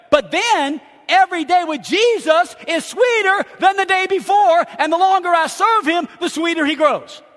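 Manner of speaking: high, loud, rising fervor; loud, elated pulpit delivery; exultant preaching. Real, not acted. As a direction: proclaim it with full joy. Preacher exulting about salvation.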